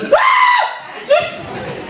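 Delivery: high falsetto shriek of panic. Retold or imitated. A clubgoer walks into a spider web and shrieks.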